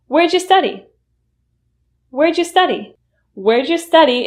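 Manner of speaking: Where'd you study with wairja, pitch lifted; reduced question. Shown as a demonstration. Teacher asking about university, reduced. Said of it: "is a question that asks".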